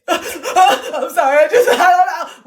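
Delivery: high, loud laughing apology; laughing hard. Real, not acted. Cracking up at her own rant, laughing hard.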